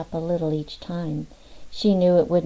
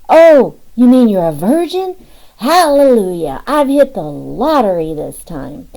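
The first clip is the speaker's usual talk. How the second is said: louder, higher gleeful burst; gleeful exclaiming; 4 semitones higher, 11 dB louder than usual. Acted. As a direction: crow over the good news, loud and high. King gleeful at hearing virgin.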